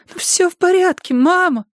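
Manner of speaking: put-on high childlike voice near tears; a small girl holding back tears; tearful girl. A narrator's reading. Girl to her mother, tearful high childlike voice. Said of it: "выговорила девочка и заплакала".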